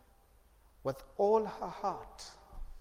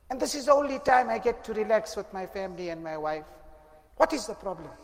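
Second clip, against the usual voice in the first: louder, higher, exasperated son snapping at his mother; irritated; 7 semitones higher, 11 dB louder than usual. Acted. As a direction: complain hotly, fed up with the interruption. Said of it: "he says in a rather irritated voice".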